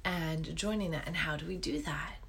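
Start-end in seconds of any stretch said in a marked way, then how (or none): 1.1-2.2 hushed, leaning-in question; whispered question. Real, not acted.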